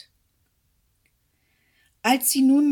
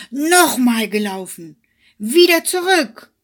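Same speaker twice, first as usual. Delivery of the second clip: loud angry yell, higher on Wieder; furious shout; 6 semitones higher, 13 dB louder than usual. Acted. Hare beside himself with anger, screaming at the hedgehog. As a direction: scream it beside yourself with rage. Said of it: "war ganz außer sich vor Ärger und schrie".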